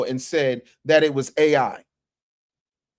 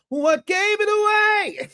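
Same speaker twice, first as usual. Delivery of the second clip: very high, drawn-out shriek; high-pitched mock cry; comic squeal; slower than usual. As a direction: squeal the catchphrase, stretching it out. Bursts out with joke catchphrase.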